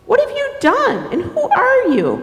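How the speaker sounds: high, affronted questioning; indignant turtle. Acted. Turtle questions the intruder in the pond, indignant. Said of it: "Turtle's like".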